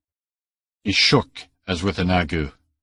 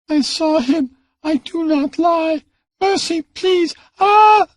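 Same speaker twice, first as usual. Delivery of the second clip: put-on very high child's cry, ending in a loud cry; desperate child screaming for mercy; child begging her beater, very high, ending in a loud cry; terrified plea; 5 dB louder than usual. A narrator's reading.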